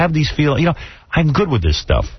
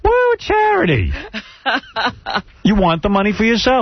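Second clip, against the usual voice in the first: high sarcastic sing-song; mock her noble charity talk; 9 semitones higher than usual. Host mocks co-host's charity plan, high sarcastic tone.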